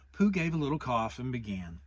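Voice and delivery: ordinary delivery, nothing marked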